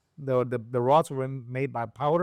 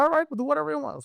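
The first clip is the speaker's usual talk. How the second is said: fast, high mock surrender; exasperated giving in; 14 semitones higher, faster than usual. Speaker caving to his tuner's whim, quick high mock exasperation. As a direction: toss it off quickly, half-annoyed.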